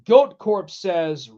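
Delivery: normal talking voice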